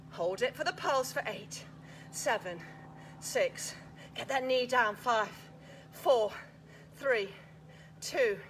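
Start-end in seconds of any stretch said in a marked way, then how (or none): none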